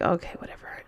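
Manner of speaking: low, very soft brush-off; dismissive mutter. Retold or imitated. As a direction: mutter it under your breath, dismissively. Retold dismissive reply to partner.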